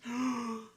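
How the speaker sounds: high, sharp intake of breath; excited gasp. Real, not acted. Streamer hearing idea of round-the-clock tank stream, excited gasp. Reacts to live-stream suggestion.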